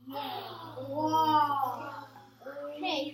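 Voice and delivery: loud, amazed whoa; excited whoa. Real, not acted. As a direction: gasp out loud at the plane. Kids react to cool plane.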